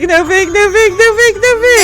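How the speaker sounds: high, hammed-up crying no fake; fake-sob the chant; mock wail. Mock-crying chat spam.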